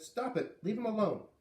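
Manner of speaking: high, firm stop it; showing how she told the lady off; mock firm demand. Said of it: "I said it like this".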